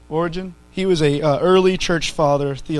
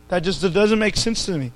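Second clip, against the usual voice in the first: ordinary talk, nothing marked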